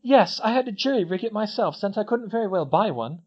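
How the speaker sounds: put-on high girlish voice, proud of her work; a clever schoolgirl boasting; Hermione. A narrator's reading. Hermione explains her still to the twins, voiced high.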